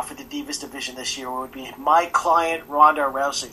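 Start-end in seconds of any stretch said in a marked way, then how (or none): 1.8-3.5 loud, booming announcer-style declaration; Paul Heyman. Retold or imitated. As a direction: announce it like a wrestling manager. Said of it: "the most terrifying four words that Paul Heyman could say".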